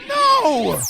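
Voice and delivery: very high desperate pony cry; pleading cry. Acted. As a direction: beg her to stop. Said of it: "I pleaded".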